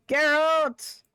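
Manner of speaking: loud, drawn-out name call. Pretend. Streamer hailing a game character, calling out. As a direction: holler the name, stretched out.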